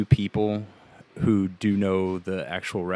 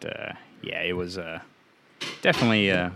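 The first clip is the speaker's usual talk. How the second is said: normal talking voice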